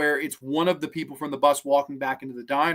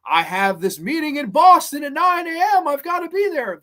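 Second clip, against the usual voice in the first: high-pitched, insistent outburst, rising louder; frantic movie character; 10 semitones higher, 5 dB louder than usual. Retold or imitated. Film fan quoting a character's line about a Boston meeting, high and insistent. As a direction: imitate a man desperate to make his meeting. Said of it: "the Bronson Pinchot character, he always says".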